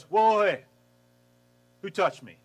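a loud startled whoa, then the question; startled call out of the crowd. Retold or imitated. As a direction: stop short and call out who touched you. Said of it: "he goes".

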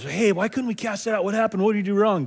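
mock-confused disciples asking their teacher; whiny disciples. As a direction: play the baffled followers begging for an answer. Disciples voiced asking Jesus.

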